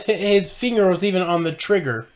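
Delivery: normal talking voice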